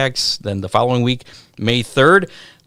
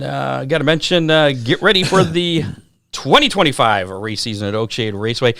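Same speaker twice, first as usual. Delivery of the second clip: ordinary talk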